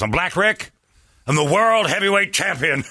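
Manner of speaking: high, loud champion's proclamation; boastful wrestler impression. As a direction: announce yourself like a hammed-up wrestling champ.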